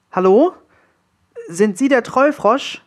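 put-on high-pitched, questioning boy hero; cautious young Willi.

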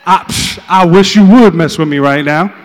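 very loud, hammed-up challenge; mock tough bravado. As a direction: bellow it like someone suddenly armed. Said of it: "she went from being scared".

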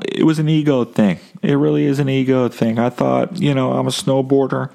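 ordinary talk, nothing marked

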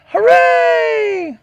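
high, loud cheering hooray; shrill-free high party shout; mock cheer. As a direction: celebrate like the staff is thrilled. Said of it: "everybody in the store goes".

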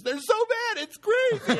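high-pitched imitation of the wife; wife's voice. Wife complaining about the farts, high imitation. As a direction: squeak it out like her. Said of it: "she goes".

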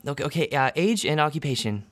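the bubbly desk clerk greeting new arrivals; receptionist woman. Acted. GM as receptionist welcoming the test subjects, bright and chirpy.

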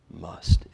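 Must struck alone, hard and firm; one firmly stressed Must. Shown as a demonstration. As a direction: hit the word Must on its own. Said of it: "I emphasize the word must".

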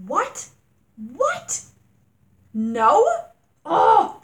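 high, loud incredulous cries; stunned, loud exclamations of outrage; outraged disbelief. Real, not acted. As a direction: shriek the disbelief. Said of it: "Didn't mean to rant".

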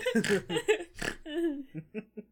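high laugh. Real, not acted. Laughing at red crayon.

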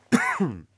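sudden sharp cough; throat-clearing cough. Real, not acted. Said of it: "got a bit of a frog in the throat".

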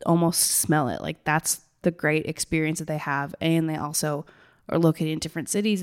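normal talking voice

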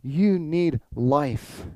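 very slow, each word weighed; Jesus, weighty and slow; slow emphatic Jesus. Retold or imitated. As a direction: spell it out slowly to them. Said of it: "Jesus is saying".

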